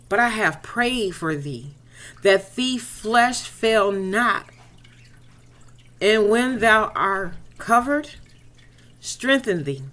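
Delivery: slow, weighty scripture recitation; solemn scripture reading. Acted. God telling Peter he prayed for him, solemn and slow. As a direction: intone it like holy writ.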